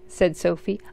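ordinary delivery, nothing marked

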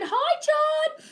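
high, drawn-out greeting; high sing-song hi. Real, not acted. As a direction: sing out hello to a viewer. Greeting viewer.

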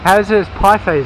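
ordinary talk, nothing marked

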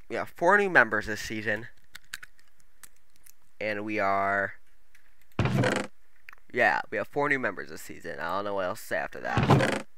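talking with a retainer in; slight clicks from a retainer; wearing a retainer. Real, not acted. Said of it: "I'm never wearing my retainer while I record".

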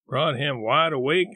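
usual speaking voice

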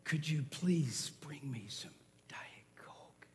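slow, low, hushed plea; polite whispered request. Acted. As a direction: ask very softly, barely audible. Repeats request too quietly.

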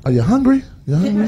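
mock sweet offer. Sudden sweet offer after argument.

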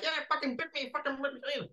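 high-pitched, panicked imitation of a bitten man; re-enacted frantic yelping; Dave Fane. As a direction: squeal it in mock outrage. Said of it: "And Dave is like".

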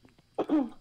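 high, loud call across the house; shouting. Real, not acted. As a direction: yell it out to the others. Calling out to teammates.

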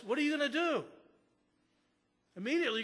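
ordinary talk, nothing marked

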